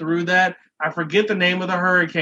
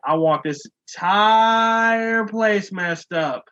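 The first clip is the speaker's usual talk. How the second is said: slower, higher, stretched-out voice of God; booming God; 4 semitones higher, slower than usual. Acted. Host voices God condemning Jersey Shore, slow mock decree. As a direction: draw out a grand divine decree. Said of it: "God literally took his index finger".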